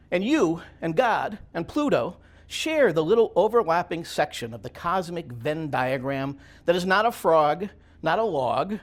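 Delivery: slow, measured poem recital with weighted phrases; deliberate, emphatic poet reading aloud; poetry reading. Acted. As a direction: declaim it line by line, pausing between images. Reads own poem aloud.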